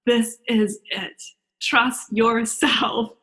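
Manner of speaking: slow, slightly hushed reassurance; spaced-out, gentle voice from within; tiny inner voice. Acted. Speaker's soul quietly reassuring her, slow and soft. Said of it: "this tiny little voice".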